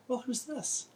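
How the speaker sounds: a hint of a curious kid's voice; Percy's voice. Boy Percy greets newcomer.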